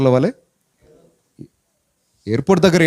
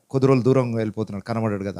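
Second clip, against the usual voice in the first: fast, much softer private ache; quiet inner grief; 6 semitones lower, 6 dB quieter, faster than usual. Retold or imitated. Mother inwardly grieving her son's leaving, quiet and fast. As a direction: murmur the worry almost to yourself. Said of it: "లోపల ఒక బాధ".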